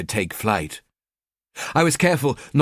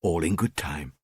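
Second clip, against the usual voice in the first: lower, softer repeat; repeated to himself; 5 semitones lower, 6 dB quieter than usual. Acted. Killer to himself, softer murmured repeat. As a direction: say it again even softer to yourself. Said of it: "I told myself".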